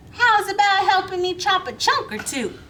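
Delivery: coaxing Miss Billy. Acted. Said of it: "said Miss Millie".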